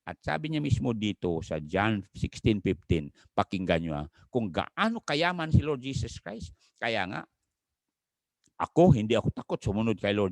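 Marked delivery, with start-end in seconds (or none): none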